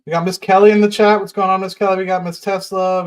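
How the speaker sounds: loud, high, cheerful shout-out; excited greeting. Real, not acted. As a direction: call out a hello to the chat. Loudly greeting a chat viewer.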